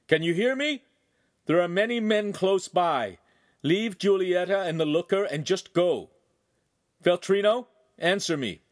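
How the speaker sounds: high, loud call into a thicket; shouting. A narrator's reading. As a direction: shout a demand to someone far away. Calling out to hidden kidnapper.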